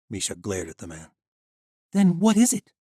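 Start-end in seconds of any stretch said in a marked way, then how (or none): 1.9-2.7 put-on high, loud young heroine; defiant young woman. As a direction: stand up to the guard. Misha glares at the man.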